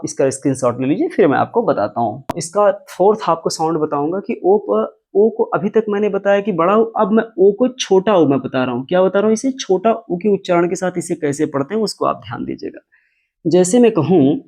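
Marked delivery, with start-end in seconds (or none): none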